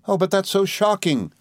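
high-pitched dismayed cry. A narrator's reading. Dora recoils from her sweetheart's serious talk, crying out. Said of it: "cried Dora".

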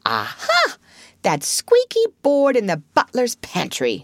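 lightly voiced eager Toad; light up as the plan clicks; Toad, catching on.

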